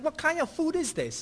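high, indignant retold complaint; mock angry. Homeless man scorning the chicken and rice, mock indignant. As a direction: imitate his scornful question. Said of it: "He was mad at me".

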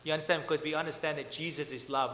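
ordinary talk

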